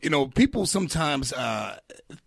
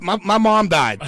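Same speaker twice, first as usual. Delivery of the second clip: ordinary delivery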